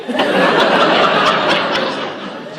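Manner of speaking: loud high burst of laughter. Real, not acted. Laughing loudly at her own runaway horse tale. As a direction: crack up completely. Laughing at little girls on ponies.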